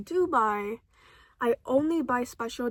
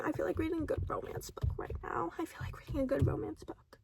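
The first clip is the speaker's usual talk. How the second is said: fast whisper, no voice; 3 semitones higher, 10 dB quieter, faster than usual. Retold or imitated. Recalling the urge to read romance, whispered fast. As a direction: hush it quickly like a secret craving. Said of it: "I was like".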